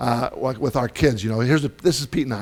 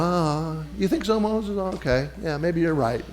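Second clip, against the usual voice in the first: softer, high, mock musing; reconsidering God; 4 semitones higher, 6 dB quieter than usual. God conceding to Moses, softly reconsidering. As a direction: give in thoughtfully, lightly voiced. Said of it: "God's there going".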